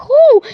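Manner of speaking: high, loud "whoo"; short high-pitched whoop imitating the characters; mock whoop. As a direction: let out a sudden loud whoo. Imitating characters in the jump scare.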